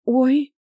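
high, loud little "oh" of pain; pained yelp. A narrator's reading. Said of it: "закусила губу от боли". (вскрик от боли)

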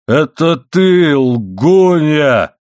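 high, slow, roared accusation; enraged yell. A narrator's reading. Husband yells back at his wife, pounding the table, enraged. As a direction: bellow it, stretching each word. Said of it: "заорал он, стуча кулаком".

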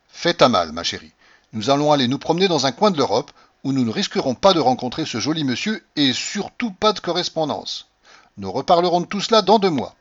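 ordre plus fort et plus haut, sans réplique; oncle autoritaire. A narrator's reading. Uncle quotes his own blunt orders. (oncle péremptoire, voix haute et appuyée)

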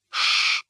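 voiceless hushing shh; shushing. Acted. D'Artagnan silencing Planchet with a voiceless shh. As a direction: hush him with a sharp breathy shh. Warning Planchet to keep quiet.

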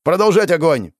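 shouted order. Acted. Order to keep firing.

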